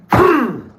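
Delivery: short high, loud stabbing sound effect; imitated stab noise. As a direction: mimic the blade going in, pitch falling. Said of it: "just like that".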